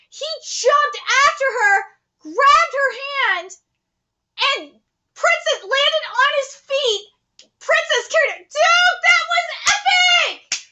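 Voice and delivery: high-pitched breathless fan raving; amazed excitement. Real, not acted. Viewer raves that he landed on his feet, amazed excitement. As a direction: marvel at it, voice way up.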